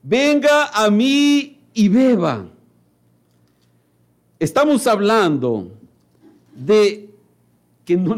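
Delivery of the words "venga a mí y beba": louder, higher, slow call; Christ's invitation. Acted. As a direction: draw out Venga like a summons. Preacher voices Christ's call.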